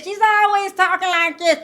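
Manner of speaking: loud high twang, mocking her pitch; imitating Dr. Heavenly. Vlogger mocking the doctor's loud Southern pitch, loud high imitation. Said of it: "It was too damn loud".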